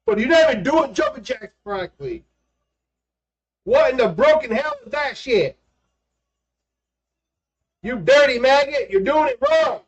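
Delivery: high, barking mock drill sergeant. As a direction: chew out a maggot recruit.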